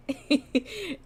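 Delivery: high-pitched giggle. Real, not acted. Streamer compares the clip to the movie, high giggle. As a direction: break into a high laugh mid-thought.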